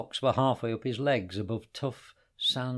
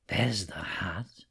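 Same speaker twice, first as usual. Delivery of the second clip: breathy whisper, slow; unvoiced slow whisper; 5 semitones lower, slower than usual. A narrator's reading. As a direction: hush it so no one hears. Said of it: "said Hassan".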